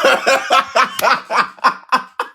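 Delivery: high, staccato burst of real laughter; long laugh. Real, not acted. Storyteller remembering his reaction to her answer, long helpless laugh. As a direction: crack up at your own story.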